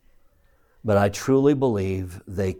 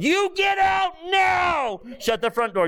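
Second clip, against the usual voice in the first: much higher, slow hammed-up shout; mock yell. Dad over the phone banishing the monster, hammed-up yell. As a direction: bellow the monster out the door. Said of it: "and I yelled".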